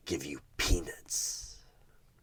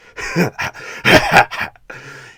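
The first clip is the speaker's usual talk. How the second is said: sinister laugh; 10 semitones higher, 11 dB louder than usual. Acted. Tempter laughs at the dream.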